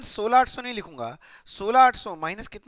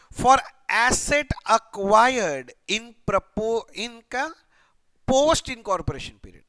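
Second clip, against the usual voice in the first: reads the question text word by word, slowly; slow question reading; 4 dB louder, much slower than usual. Shown as a demonstration. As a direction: spell out the textbook line slowly for students. (धीरे-धीरे प्रश्न पढ़ते हुए)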